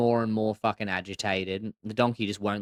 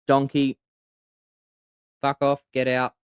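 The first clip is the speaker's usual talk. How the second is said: firm, louder royal dismissal, with long pauses; lightly voiced commanding lion; lion king ruling; 4 semitones higher, 6 dB louder than usual. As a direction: pass judgement as the lion king, pausing after the name. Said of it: "And the lion goes".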